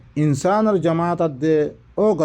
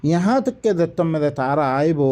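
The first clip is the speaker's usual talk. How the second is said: ordinary talk, nothing marked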